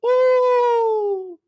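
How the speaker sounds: very high, long-held touchdown cry; high-pitched, drawn-out cheer; celebratory whoop. Real, not acted. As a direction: whoop it way up high and hold the note.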